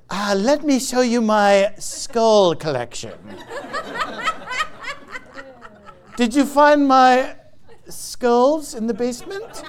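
slow, creepy put-on voice; creepy mock monk. Mocking Orthodox skull collectors in a creepy voice. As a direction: leer it slowly like a ghoulish host.

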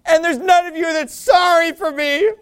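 high, loud mock wail ending in a sob; hammed-up whining self-pity, breaking into bawling. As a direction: bawl like a spoiled, wounded king. Preacher mocks Saul's self-pity.

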